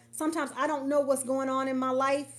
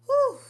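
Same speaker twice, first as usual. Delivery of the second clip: high loud exhale of relief; loud high whew; 14 semitones higher, 9 dB louder than usual. Real, not acted. Reacts to comment.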